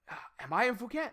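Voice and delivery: fast, puzzled mock question; confused friend. Imitating Harry unsure if he's in Phuket, mock confusion. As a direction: play dumb and lost. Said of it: "And Harry goes".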